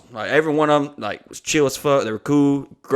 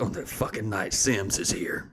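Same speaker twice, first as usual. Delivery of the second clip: soft, lower, unvoiced grumble; whispered complaint; 4 semitones lower, 9 dB quieter than usual. Owner whispering to his wife at the blinds. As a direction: hiss it quietly so the guest won't hear. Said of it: "they're whispering to each other".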